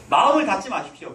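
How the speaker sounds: usual speaking voice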